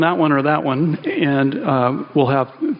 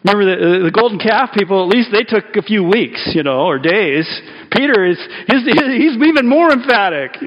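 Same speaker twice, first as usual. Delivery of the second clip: rising excitement; 5 semitones higher than usual. Real, not acted. Preacher working up the crowd, getting excited. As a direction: build it up, getting worked up.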